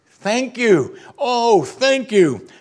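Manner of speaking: high, loud cry of gratitude; ringing, emphatic praise; fervent thanks. Acted. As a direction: exclaim it to God, drawn out. Acted outburst of thanks to God.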